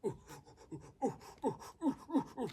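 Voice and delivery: giddy oohs climbing in pitch; excited oohs. Retold or imitated. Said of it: "I got really excited".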